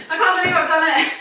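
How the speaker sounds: breathless laugh, quick and bright; laughing in disbelief. Real, not acted. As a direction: laugh it out, amazed you finished. Finishing a hard squat set.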